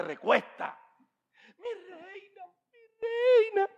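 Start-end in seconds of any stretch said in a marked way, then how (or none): 1.6-3.7 very high crying plea; hammed-up sobbing voice; mock weeping plea. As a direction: beg the queen in tears. Haman begs queen, crying.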